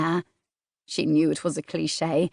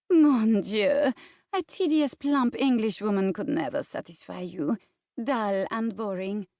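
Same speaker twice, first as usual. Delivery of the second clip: put-on scornful French accent; 3 semitones higher than usual. Celeste mocking the English fiancée, scornful French accent. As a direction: belittle the rival in a French accent, fading to a sneer. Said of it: "the teasing French accent".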